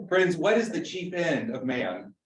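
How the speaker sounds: normal talking voice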